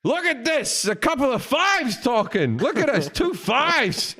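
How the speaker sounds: high-pitched, exclaiming impression; hammed-up movie star calling attention; Al Pacino. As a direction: crow it out high, drawing out the words. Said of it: "Al Pacino comes up to me and goes".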